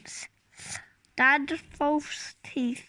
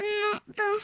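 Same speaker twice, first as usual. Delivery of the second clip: plain reading voice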